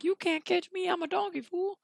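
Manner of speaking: high-pitched taunting donkey voice. Acted. Donkey taunts pursuers while running, high and teasing. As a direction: tease in a high put-on voice. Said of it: "as Donkey was running, he was like".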